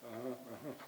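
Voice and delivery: low, soft, absent-minded acknowledgement; half-listening, absorbed in the phone; distracted texter. Wife texting in bed while husband talks, distracted mumbled replies. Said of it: "and she's texting".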